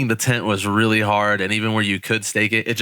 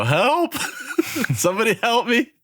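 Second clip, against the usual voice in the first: mock-panicked high cry, laughing through it; retold panicked cry for help; 14 semitones higher than usual. Camper under a fallen tent calls for help, mock panic. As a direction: play up a squealed plea for rescue. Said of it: "So I start freaking out".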